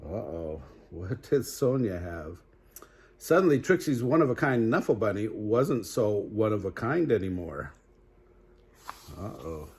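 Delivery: ordinary delivery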